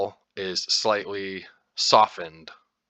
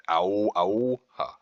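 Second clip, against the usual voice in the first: Icelandic pronunciation; slower than usual. Retold or imitated. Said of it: "where they say Hávamál".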